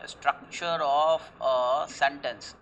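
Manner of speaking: very slow, word by word, like dictating a definition; slow dictation. Shown as a demonstration. Teacher dictating a definition to class, very slow. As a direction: spell it out slowly for students to write down. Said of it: "Syntax means what?".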